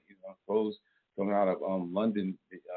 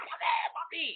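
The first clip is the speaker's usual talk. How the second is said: Spanish girl impression; slower than usual. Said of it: "Spanish girl say".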